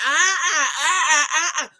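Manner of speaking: mock sputtering laugh; laughing stammer. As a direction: splutter through laughter as the brother. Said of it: "was like".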